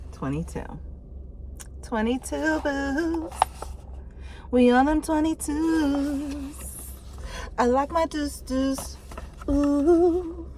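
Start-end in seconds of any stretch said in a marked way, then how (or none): 1.8-10.5 playful hype. Pretend. Playful hype about twenty-two boots.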